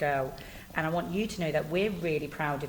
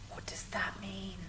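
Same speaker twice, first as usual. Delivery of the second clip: hushed conspiratorial question; playful whisper; 9 dB quieter than usual. Acted. Teacher whispers a question to the pupil. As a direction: whisper it like a shared secret.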